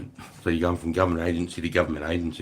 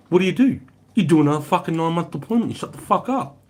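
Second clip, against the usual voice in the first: raised pitch, rapid-fire retort; mock stern order; 10 semitones higher, 4 dB louder, faster than usual. Telling a professional soldier to redeploy, mock stern order. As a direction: lay down the law, high and fast.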